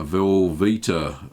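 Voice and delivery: usual speaking voice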